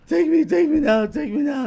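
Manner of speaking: high, loud, slow cracking plea. Retold or imitated. Imitating friend's voice cracking while begging for a ride. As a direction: let the voice crack as you beg. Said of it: "Cracking a little".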